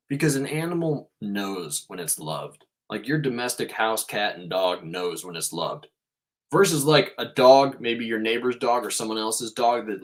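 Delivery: usual speaking voice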